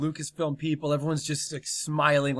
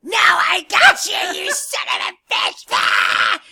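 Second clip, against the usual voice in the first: much higher, much louder screaming villain; monstrous shriek; 10 dB louder, slower than usual. Acted. Kathleen turning on the director with a monstrous shriek. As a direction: shriek it like a monster springing its trap.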